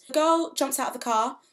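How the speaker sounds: ordinary delivery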